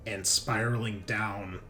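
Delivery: normal talking voice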